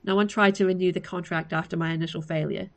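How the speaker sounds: usual speaking voice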